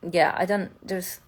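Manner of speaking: usual speaking voice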